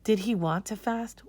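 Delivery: ordinary delivery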